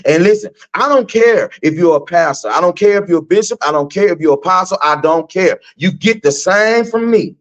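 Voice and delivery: usual speaking voice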